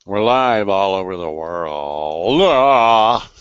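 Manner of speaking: slow, stretched broadcast call swelling at the end; hammed-up live show announcement; mock announcer.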